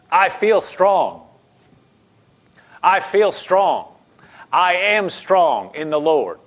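slow, emphatic, firm affirmation; deliberate confession of strength; bold declaration. Shown as a demonstration. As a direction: declare it slowly, leaning on each word. Models a defiant confession of strength.

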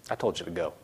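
low, soft, final order; understated authority; calm firm command. Acted. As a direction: quietly dismiss the devil, no argument. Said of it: "It's not how you say it".

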